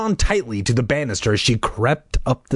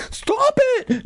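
high angry little-brother voice; angry cry. Acted. Noah cried at his sister, angry but afraid. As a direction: cry out sharply, fear underneath. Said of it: "His voice sounded angry".

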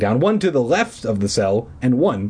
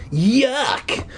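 disgust; 14 semitones higher than usual. A narrator's reading. Disgust at cobwebs.

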